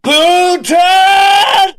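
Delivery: high shrieked name; mock shout. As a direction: yell the name like an accusation.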